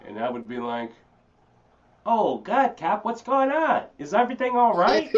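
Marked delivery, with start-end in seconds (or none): 2.0-5.1 high, loud squeal of confusion; naive put-on cop-pleasing voice. Driver pulled over, talking to the cops, high put-on voice. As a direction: act alarmed and clueless, pitched way up. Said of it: "I had a voice like this".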